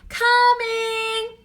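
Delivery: high, sung-out answer; calling back. A narrator's reading. Annie answering Mom from the window, called out. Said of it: "she shouted".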